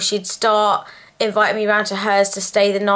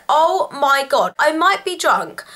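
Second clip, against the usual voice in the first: slow, higher-pitched mock horror; appalled disbelief; 6 semitones higher, slower than usual. Tipsy woman reacting to friend's attempted snog, appalled. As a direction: draw it out in retold disbelief. Retold shock at friend leaning in.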